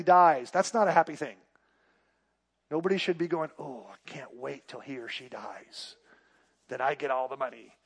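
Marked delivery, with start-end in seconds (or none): 3.6-7.8 hushed, low, greedy mock muttering; a quiet, sly put-on voice; greedy heir. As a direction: play the heir who wants the money, softly and slyly.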